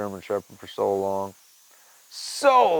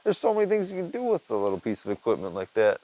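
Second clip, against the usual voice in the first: usual speaking voice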